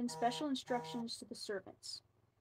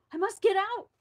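much higher, much louder, gasping cry; desperate gasp; 10 semitones higher, 12 dB louder than usual. Acted. Thirsty princess gasping at the locked door, frantic. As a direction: choke it out, desperate for air. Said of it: "she gasped".

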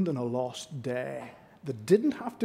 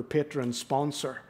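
normal talking voice

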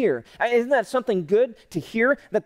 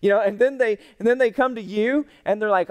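ordinary delivery

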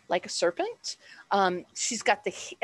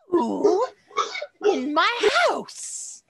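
high, loud furious demand; angry snake woman shout; 10 semitones higher, 6 dB louder, much slower than usual. Acted. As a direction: snap it out in outrage. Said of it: "she's like".

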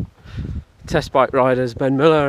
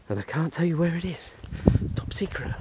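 hushed conspiratorial whisper; 4 semitones higher, 6 dB quieter than usual. Real, not acted. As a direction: whisper it like a secret. Confiding a secret location.

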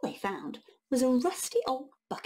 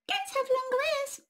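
much higher squeaky mouse voice; tiny mouse voice. Acted. Mouse answering the troll in a tiny high voice. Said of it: "said the mouse".

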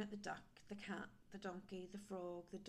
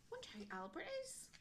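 usual speaking voice

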